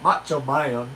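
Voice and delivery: lightly voiced wrestler name-call, vowels stretched; a low hint of the wrestler, drawn out; Macho Man. Comic naming his impression for the audience, Macho Man voice. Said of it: "to do Forrest Gump and, and Macho Man".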